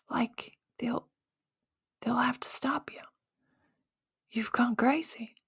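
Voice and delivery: hushed, breathy warning from her family; whispered warning. Retold or imitated. Family whispering that she's gone too far.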